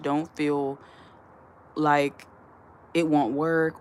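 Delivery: normal talking voice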